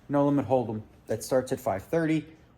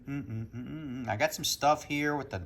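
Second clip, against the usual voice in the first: normal talking voice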